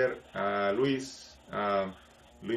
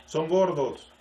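retold shout; 4 semitones higher, 3 dB louder, much slower than usual. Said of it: "gritaba una señora".

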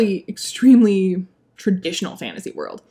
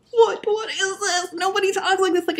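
laugh-cry in disbelief; laughing exasperation; 9 semitones higher than usual. Real, not acted. Reviewer laughing in despair at the dialogue.

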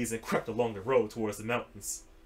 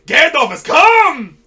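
slow, high, very loud shout; battle cry; 19 dB louder, slower than usual. Acted. Defenders hailing Gandalf, loud triumphant shout. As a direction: shout it to the army.

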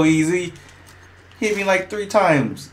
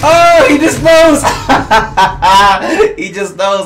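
loud high cry breaking into laughter; laughing, loud at first then settling; laughing dismay; 5 semitones higher, 5 dB louder than usual. Real, not acted. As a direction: crack up while realizing you are beaten. Laughing at being outplayed.

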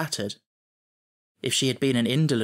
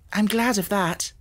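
put-on young Sarah; 7 semitones higher than usual. A narrator's reading. Sarah answers Ermengarde.

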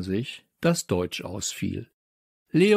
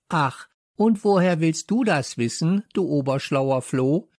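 high, mocking challenge; taunting; 7 semitones higher, 3 dB louder than usual. A narrator's reading. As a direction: sneer back at the classmate. Classmate squares up in a quarrel.

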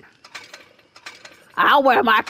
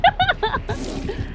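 high, quiet titter; soft high giggle; 7 dB quieter than usual. Real, not acted. Laughs after the exchange.

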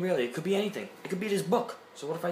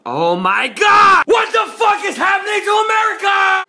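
high, loud furious yell; raging gamer shout; 15 dB louder than usual. Acted. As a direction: scream it at the screen.

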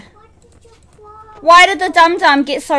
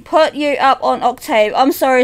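usual speaking voice

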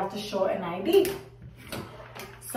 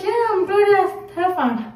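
higher, louder, bright confirmation; customer voiced; 10 semitones higher, 4 dB louder than usual. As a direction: say it proudly as the star herself. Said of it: "She's like".

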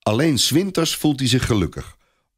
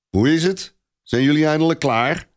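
higher, booming knight's call; 7 semitones higher than usual. A narrator's reading. Du Guesclin calling into the smithy, booming. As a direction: boom it out across the smithy. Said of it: "dreunt hij".

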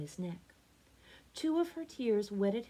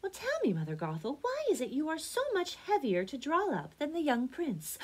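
high, lilting maiden voice; innocent Rapunzel; 8 semitones higher than usual. A narrator's reading. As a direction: guileless question in a lifted girlish pitch.

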